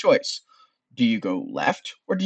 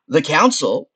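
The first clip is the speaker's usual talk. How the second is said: lightly voiced skull, higher and louder; Frank; 4 semitones higher, 7 dB louder, slower than usual. Skull reacting to news of the council, lightly voiced. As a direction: echo it loudly as the skull. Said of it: "explains Frank".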